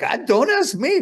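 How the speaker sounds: high, loud, laughing shrug; mock bewilderment. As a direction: toss it off, high and incredulous. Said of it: "I'm like".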